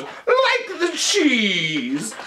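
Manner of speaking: high, hugely stretched-out hammy repeat; hammy film doctor. Retold or imitated. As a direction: drag it out high, like a mad scientist. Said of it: "And then he goes".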